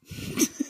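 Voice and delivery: short breathy chuckle; quiet snicker to yourself; laugh. Real, not acted. Amused by the prank story, short chuckle.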